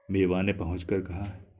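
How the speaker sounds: usual speaking voice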